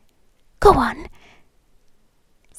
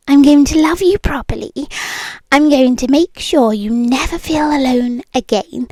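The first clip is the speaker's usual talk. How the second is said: usual speaking voice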